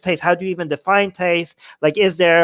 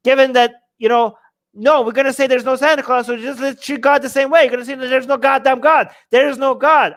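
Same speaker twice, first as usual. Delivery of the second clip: heated rant; 5 semitones higher than usual. Real, not acted. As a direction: hammer it in like a fired-up debater. Building rant against religion.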